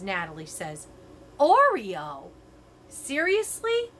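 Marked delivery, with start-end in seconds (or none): 1.4-3.9 indignant disbelief. A narrator's reading. Narrator offended retort.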